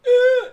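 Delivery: loud, high-pitched laugh breaking out; high burst of laughter. Real, not acted. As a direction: crack up with a high squeal. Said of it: "say it without laughing".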